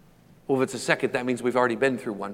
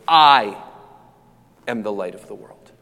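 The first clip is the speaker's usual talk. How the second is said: "I" hit loud and held, the rest dropping away; stress on "I"; 3 semitones lower, 7 dB louder than usual. Acted. Preacher voicing Jesus' emphatic claim, stress on "I". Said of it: "it was on the first word of his sentence".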